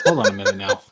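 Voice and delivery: high-pitched laughing protest; laughing disbelief. Real, not acted. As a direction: laugh through the words.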